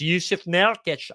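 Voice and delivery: put-on Scottish accent, name stretched out. Giving viewers his pick, Scottish accent. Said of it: "do the Scottish accent every time".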